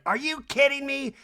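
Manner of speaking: high-pitched put-on indignation; mock outrage. Kyle sitting out front, mock outraged. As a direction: imitate an exasperated housewife. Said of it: "Kyle's like".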